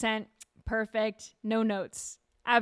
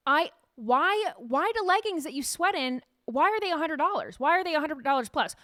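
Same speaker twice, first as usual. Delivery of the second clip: exasperated rant; 6 semitones higher than usual. Real, not acted. Rant about pricey leggings.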